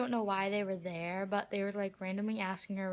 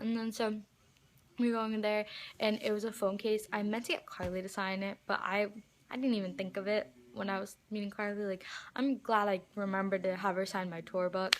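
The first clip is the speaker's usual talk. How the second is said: ordinary talk, nothing marked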